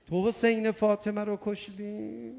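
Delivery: usual speaking voice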